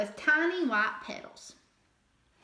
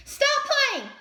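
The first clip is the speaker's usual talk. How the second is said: high, loud call to stop; crying out; 13 semitones higher, 6 dB louder than usual. A narrator's reading. Fletcher calls out to the playing rabbits, loud and high. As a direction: shout it at the rolling rabbits. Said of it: "cried Fletcher".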